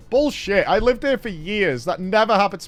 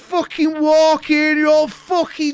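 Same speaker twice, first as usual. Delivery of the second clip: put-on drunk angry shout; 8 semitones higher, slower than usual. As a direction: bellow it like a furious drunk old man. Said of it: "he says this as he walks through".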